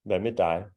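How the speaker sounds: "dig" pronounced "dai", G silent; as really said. Shown as a demonstration. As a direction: say "dig" rhyming with English "eye". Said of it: "but we say dig".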